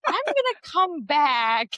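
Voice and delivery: high, slow, drawn-out backing-off; hammed-up awkward retreat, stretched out; imitated wary partner. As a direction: mimic a partner cautiously excusing himself. Imitates partner backing away from tears.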